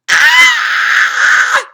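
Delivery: piercing high scream of pain; shrill scream. A narrator's reading. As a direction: scream as the pigtail is yanked. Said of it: "screamed Leslie".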